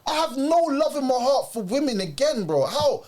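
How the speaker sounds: hammed-up heartbroken rant at the cheating girlfriend; indignant rant. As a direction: vent the betrayal at her.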